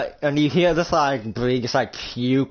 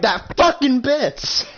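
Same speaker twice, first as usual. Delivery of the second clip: high, loud, drawn-out yell; enraged outburst; 13 semitones higher, 5 dB louder, much slower than usual. Acted. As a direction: stretch the curse out in acted fury. Acted rage after phone call.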